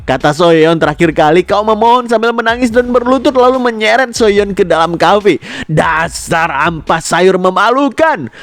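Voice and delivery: high-pitched exasperated insult; makian kesal. Acted. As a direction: start high, then drag out the final insult. Si rambut biru memaki Hyun. (memaki kesal dengan nada tinggi)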